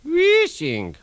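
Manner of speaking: wheeshing said as the hiss itself; mock wheesh. A narrator's reading. Fat Controller imitating the engines' steam, high mock wheesh. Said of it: "Kindly remember".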